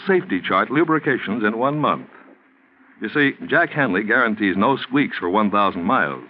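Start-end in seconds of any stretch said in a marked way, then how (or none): none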